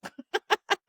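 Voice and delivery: short high laugh. Real, not acted. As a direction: burst out laughing.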